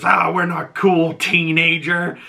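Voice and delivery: high, drawn-out mock scolding; scolding retort. Retold angry reply, full-name scolding.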